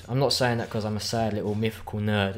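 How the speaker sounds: ordinary delivery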